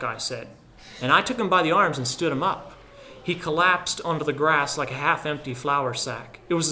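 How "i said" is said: plain reading voice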